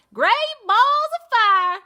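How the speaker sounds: high, loud southern goose cry. Acted. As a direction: belt it out like a brash farm goose. Goose cries, read-aloud story.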